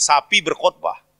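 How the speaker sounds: demonstrated Papuan contraction; contracted Papuan speech. Shown as a demonstration. Preacher repeating the Papuan answer, imitated accent. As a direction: say "sapi" for "saya pergi", Papuan style. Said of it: "Cepet-cepet orang Papua".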